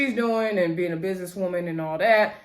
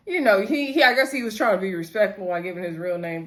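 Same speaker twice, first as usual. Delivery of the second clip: ordinary talk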